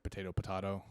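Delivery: potato as "po-TAY-to", then "po-TAH-to"; potato with long a, then broad a; two pronunciations. Shown as a demonstration. Arguing it is just pronunciation, potato two ways. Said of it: "just how you pronounce".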